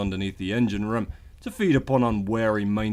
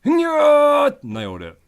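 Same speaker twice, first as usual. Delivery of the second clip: smug triumph; 8 dB louder, much slower than usual. Acted. Triumphant after jump.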